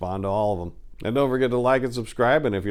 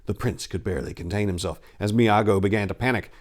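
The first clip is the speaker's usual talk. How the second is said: plain reading voice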